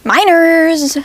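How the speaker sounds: high, loud cry; mock yell. [name] yells before running away, high mock cry. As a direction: shriek it and run.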